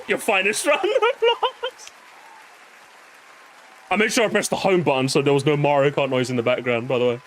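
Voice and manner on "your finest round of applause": high, slow, laughing fanfare; laughing announcement. Real, not acted. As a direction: announce it while cracking up.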